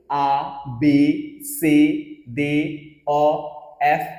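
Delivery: आ बे से spelled out very slowly, letter by letter; French alphabet. Shown as a demonstration. Teacher reciting French letters slowly for students to repeat. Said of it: "एक साथ मेरे साथ बोलो".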